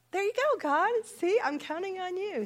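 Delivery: high, bright cheer to God; excited inner voice. Retold or imitated. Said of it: "And I thought".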